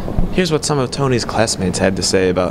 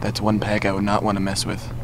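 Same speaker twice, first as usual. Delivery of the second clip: hushed wildlife-show narrator; nature documentary; 3 dB quieter than usual. Acted. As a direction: narrate like stalking wild animals.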